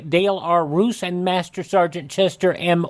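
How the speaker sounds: ordinary delivery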